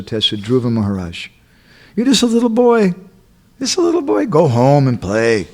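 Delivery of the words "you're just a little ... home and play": high-pitched teasing put-down; high, dismissive acted scolding; mock dismissal. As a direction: shoo a little child away in a raised voice. Acting Narad testing the boy.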